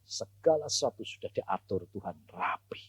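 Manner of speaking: hushed unvoiced reassurance; whisper. Real, not acted. Preacher hushing to reassure his listeners, whispered. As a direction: whisper it like a secret comfort.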